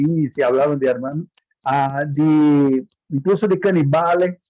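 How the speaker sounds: usual speaking voice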